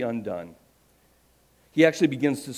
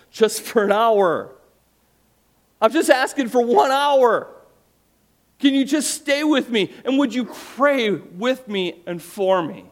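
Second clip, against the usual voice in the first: slow, much higher, louder plea; pleading Jesus; 11 semitones higher, 7 dB louder, slower than usual. Acted. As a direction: beg gently for one hour of company.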